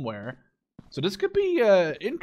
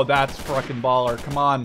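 normal talking voice

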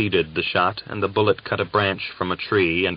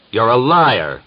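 much louder furious shout; 4 semitones higher, 9 dB louder than usual. A narrator's reading. Gregor accuses Uryupin of lying, furious shout. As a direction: hurl the accusation at him. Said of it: "Gregor shouted".

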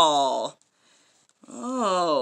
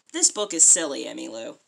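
ordinary delivery, nothing marked

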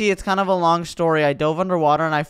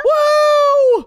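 excited whoop; 7 dB louder than usual. Real, not acted. Jumping into the water.

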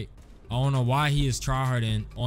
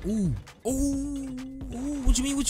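excited squeal; 11 semitones higher than usual. Real, not acted. Reacting to player in game.

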